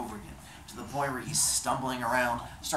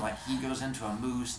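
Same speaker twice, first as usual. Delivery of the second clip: ordinary delivery, nothing marked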